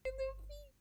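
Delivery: overwhelmed by cuteness. Real, not acted. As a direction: squeal over a cute kitten. Cat lies down, squealing at cuteness.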